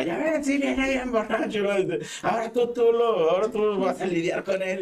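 mother telling the brother to deal with him, high and fed up; exasperated mother. Retold or imitated. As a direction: hand off the drunk son in disgust. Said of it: "mi jefa".

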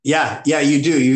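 ordinary delivery

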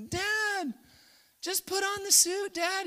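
put-on high, whiny child nagging his dad; annoying kid voice. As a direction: pester him to put the suit on. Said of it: "He's kind of an annoying voice".

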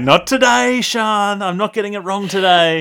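high, loud mock-determined protest; mock defiance. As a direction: protest theatrically at your co-host. Acts out defiant thought to co-host.

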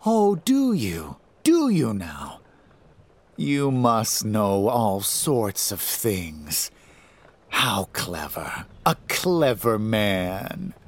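slow, taunting sing-song sneer; mock him like a cruel trickster; mocking demon voice. A narrator's reading. Typhon mocking Bryce.

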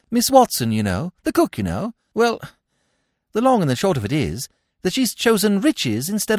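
put-on high, loud, stumbling young gentleman; flustered upper-class chap. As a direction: break it awkwardly, pitched well up. Bertie flusters through bad news.

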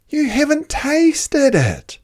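high, slow, coaxing; sly animals. Acted. The animals coax Dingo to taste the stew. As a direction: trick him into tasting.

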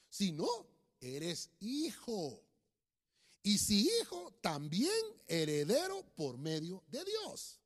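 slow, declaimed Bible verse; solemn, emphatic scripture reading; preacher reading scripture. Real, not acted. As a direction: stretch key words and pause between phrases. Reads scripture aloud to congregation.

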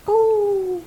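high, loud wolf-pup howl; little wolf howl. Acted. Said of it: "I'm gonna do a little howl".

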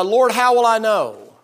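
high, loud and slow, begging the Lord; pleading Abram. Acted. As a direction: beg for an answer, drawn out.

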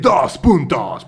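lento y fuerte, como anuncio pomposo; grito de anuncio. Pretend. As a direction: alarga el grito con burla. (grito pomposo de burla)

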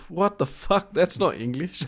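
high-pitched incredulous outburst; mock disbelief. Listener baffled by Cockney speech, mock disbelief. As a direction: react as if baffled by what was just heard. Imagined reaction to hearing Cockney.

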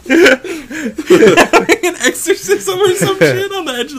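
high laughing speech. Real, not acted. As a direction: talk while cracking up. Laughing through the joke.

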